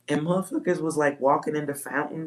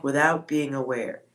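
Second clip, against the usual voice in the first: ordinary delivery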